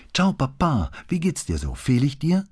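high, eager little daughter; excited kid on the phone to Dad; child's voice. A narrator's reading. Daughter takes the phone to father.

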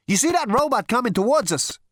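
high, loud question; urgent call. Acted. Zaphod alerting Marvin to an advancing robot, urgent loud call.

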